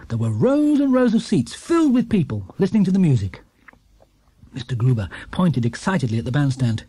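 ordinary delivery, nothing marked

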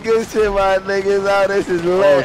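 high, loud hyped-up imitation; mock-hyped friend voice. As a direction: parrot the friend's excited shout.

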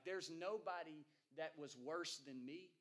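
usual speaking voice

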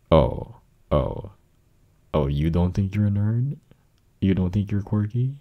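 oh-really teasing; teasing disbelief. Acted. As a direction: challenge them playfully, pitch climbing at the end. Teasing challenge of partner's denial.

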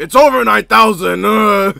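high, full-force anime yell with a grunt; legendary meme shout. Retold or imitated. Quoting the legendary Vegeta meme, all-out shout. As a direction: bellow it like a furious warrior. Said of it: "we can't forget the legendary".

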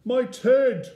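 high, very loud startled cry; big high-pitched bear's gasp; shocked gasp. A narrator's reading. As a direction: exclaim it in sudden shock. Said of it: "gasped the bear".

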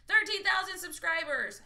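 thrilled streamer repeating the milestone; excited. Real, not acted. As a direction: proudly shout out the number.

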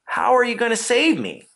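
high-pitched eager question; keen curiosity, pitched well up; very curious. A narrator's reading. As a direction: ask in a high, wondering voice. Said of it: "whose curiosity was very strong at this point".

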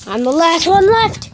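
loud, high, hammed-up yell of defiance; triumphant shout. Last survivor yelling at the empty neighborhood, loud hammed-up shout. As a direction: belt it out like the last survivor standing. Dramatic game opening shout.

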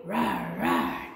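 very low growling rah; dinosaur roar. Pretend. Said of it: "Get your dinosaur voices".

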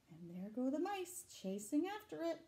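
usual speaking voice

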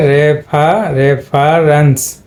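slow রেফারেন্স with short e in ref; syllable breakdown. Shown as a demonstration. Teacher breaking the word down for students, slow ref-a-rens for রেফারেন্স. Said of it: "এখানে কিন্তু একার".

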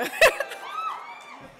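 high, delighted burst of laughter. Real, not acted. Speaker greeted by cheers on stage, high laugh. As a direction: laugh at the big welcome.

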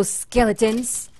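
normal talking voice

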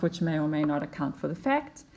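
ordinary delivery

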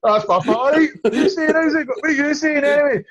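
high, loud hammed-up pally greeting; mock pal greeting. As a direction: shout it like Bolt's your best mate. Jokingly greets Bolt as pal.

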